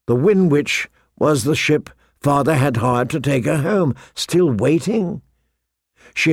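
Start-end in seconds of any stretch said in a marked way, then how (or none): none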